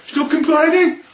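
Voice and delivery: high, exasperated Birmingham plea; mock Birmingham whinge; whining Brummie accent. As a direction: protest in exaggerated Brummie. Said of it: "I want to say".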